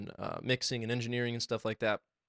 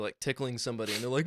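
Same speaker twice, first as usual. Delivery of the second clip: usual speaking voice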